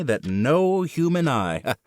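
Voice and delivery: ordinary delivery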